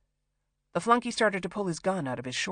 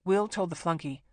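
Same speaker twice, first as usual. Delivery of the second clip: ordinary delivery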